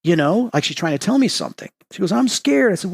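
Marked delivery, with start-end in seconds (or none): 2.1-2.7 much higher, mock frightened girl; a small imitated plea of fear; scared girl. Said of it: "She's terrified of something".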